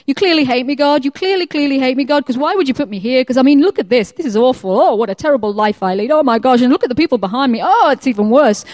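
hammed-up woe-is-me wailing at God; mock despairing complaint. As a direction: moan in exaggerated self-pity. Acting out the miserable choice of life.

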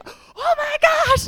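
high, loud, drawn-out shriek of amazement; astounded. Retold or imitated. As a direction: imitate someone shocked, slow and very high-pitched. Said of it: "she was so astounded".

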